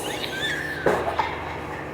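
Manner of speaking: breathless high-pitched cackle; helpless giggling fit; high squealing laugh. Real, not acted. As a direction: lose it completely, squeal with laughter. Cracking up at own story.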